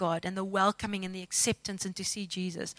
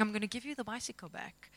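quiet replay in head; 14 dB quieter than usual. Retold or imitated. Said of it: "this tape playing in my head".

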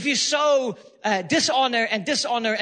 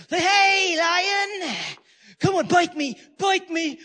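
high, loud mock taunt; cocky taunting; 6 semitones higher, 3 dB louder, slower than usual. Young rebel heckles a caged lion at the zoo, cocky taunting. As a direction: goad it like an overconfident young rebel. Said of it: "he's so cocky".

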